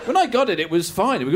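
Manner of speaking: ordinary talk, nothing marked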